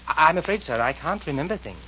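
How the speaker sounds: put-on high, hesitant girl's voice; Alice. Alice voiced in story reading.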